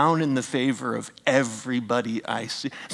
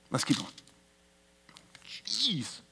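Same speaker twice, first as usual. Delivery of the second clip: whispered aside; 3 semitones higher, 11 dB quieter than usual. Real, not acted. Aside to self.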